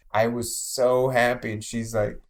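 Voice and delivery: normal talking voice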